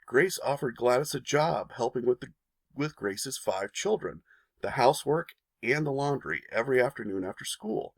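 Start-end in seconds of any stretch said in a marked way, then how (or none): none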